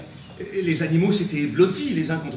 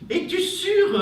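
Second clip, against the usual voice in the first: high character voice; 13 semitones higher, 5 dB louder, slower than usual. Acted. Animal characters argue.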